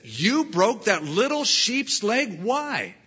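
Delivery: a high, stunned question from the woman; shocked disbelief. Retold or imitated. Tourist asking the shepherd why, in shocked disbelief. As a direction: voice the woman's shock at the broken leg.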